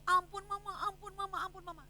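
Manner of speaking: put-on high pleading child's voice, fading; child begging. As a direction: beg mama for mercy like a little girl. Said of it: "sampai dia bilang".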